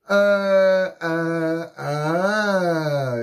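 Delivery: high, loud, drawn-out uhs; stunned "uh". Retold or imitated. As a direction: mimic being frozen in shock. Acting out shock at armed police.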